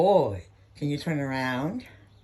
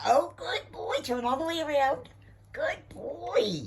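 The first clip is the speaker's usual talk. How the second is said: much higher, thrilled pet praise; excited praise; 9 semitones higher, slower than usual. Real, not acted. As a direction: gush over the dog. Praising dog, pitch soars.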